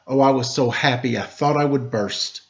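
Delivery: ordinary delivery, nothing marked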